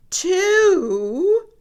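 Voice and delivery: Piggy counting, getting excited. Acted. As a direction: count up eagerly, voice lifting.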